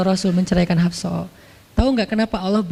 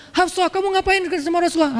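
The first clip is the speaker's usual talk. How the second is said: scolding father; 7 semitones higher than usual. Acted. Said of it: "Langsung Umar marah-marah".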